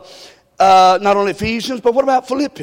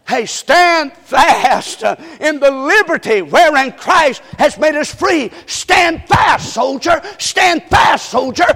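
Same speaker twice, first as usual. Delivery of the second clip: rallying preacher's cry; 5 semitones higher, slower than usual. Acted. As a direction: rouse the soldiers of faith.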